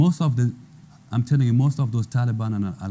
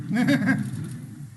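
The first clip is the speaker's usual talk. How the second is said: short laugh; 14 semitones higher than usual. Real, not acted. Laughs after mother slap story.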